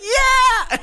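very high, loud squealed yeah; girl agreeing. Retold or imitated. Girl on the boat agreeing with a very high loud yeah. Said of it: "And she's like".

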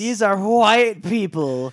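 high, loud, slow mock outrage; mock news reporter. Mocking reporters on the war, slow scandalized delivery. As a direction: draw it out like an appalled correspondent. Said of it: "all these reporters, too, are like".

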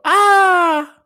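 high shrieking scream; a sudden frightened shriek; startled scream. Retold or imitated.

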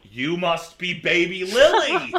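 loud, slow, laughing delight; delighted exclamation. Acted. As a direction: greet the grown baby with joy. Old man excited recognizing Lily.

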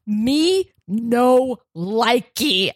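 put-on slow, childlike pouting voice; babyish mock refusal. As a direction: whine it like a toddler. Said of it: "I was just like".

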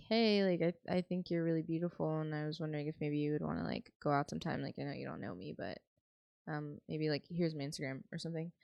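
soft, low, tentative pick-up line; modelling a low-key, unpushy approach; normal, casual approach. Acted. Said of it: "just be normal".

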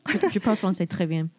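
put-on playful French spoken with a French accent; hammed-up French accent; fake French accent. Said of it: "fake French accents".

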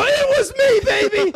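high, loud gloating yell; triumphant shout. Retold or imitated. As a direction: crow it like a victory replay. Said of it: "I'm playing that clip".

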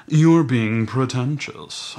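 mock-posh drawl; snooty accent. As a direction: drag it out like a haughty snob. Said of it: "say pretentious with an accent".

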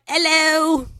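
high, hammed-up British hello; bad British accent. Answering imagined praise with a bad British accent. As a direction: show off a fake British accent, pitched high. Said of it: "you have a great British accent".

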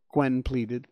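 plain reading voice

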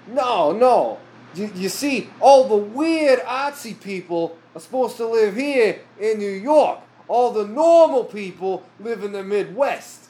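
put-on high, slow Brooklyn accent. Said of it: "very stereotypical Brooklyn accent".

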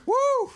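a high, loud celebratory woo; loud whoop. Real, not acted. Whoops after caller hangs up.

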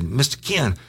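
high, whiny imitation of a crying caller; mock tearful plea. Frightened kid phoning Ken for help, mock tearful pleading. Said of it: "Motherfucker was crying".

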